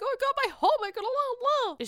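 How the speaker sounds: high, squeaky panicked mimic; imitating panicked Ramona. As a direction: squeal it like she's losing it. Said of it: "Ramona's having a heart attack".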